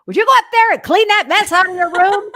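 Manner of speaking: put-on mother yelling at her child to clean up; mother scolding. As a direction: snap at the kid about the messy room. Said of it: "an hour before she was saying".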